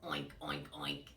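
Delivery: three low oinks; pig oinks. Shown as a demonstration. Leading children in three oinks, low piggy voice. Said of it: "Give me three oinks".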